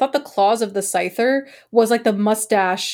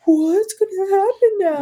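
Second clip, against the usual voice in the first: high gasp, then a slow worried question; retold childhood alarm, pitched up; kid's gasp of shock; 7 semitones higher, slower than usual. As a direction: gasp and ask it like a scared kid. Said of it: "I was like".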